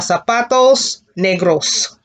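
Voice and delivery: zapatos negros said slowly, each syllable clear; slow model word. Shown as a demonstration. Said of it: "the color what".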